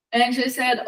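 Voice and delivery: plain reading voice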